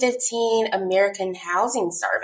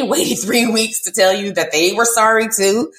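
normal talking voice

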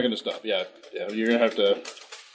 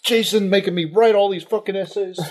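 put-on angry teen rant; 7 semitones higher than usual. [name] griping in character.

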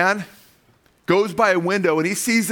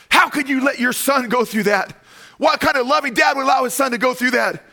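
higher and louder, hammed-up outrage; outraged accusation; 10 semitones higher, 4 dB louder than usual. The rescuer confronting the father who watched, outraged and loud. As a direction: demand answers from the father, appalled.

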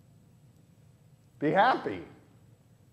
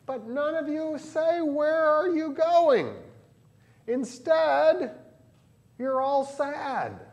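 much higher and slow, a gentle chiding teacher; gently chiding Jesus; 11 semitones higher, slower than usual. Retold or imitated. Jesus gently chides his sad disciples, higher and slow. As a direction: ask them slowly, higher, almost pleading.

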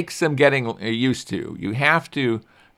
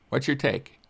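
ordinary talk

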